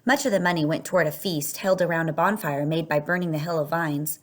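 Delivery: ordinary delivery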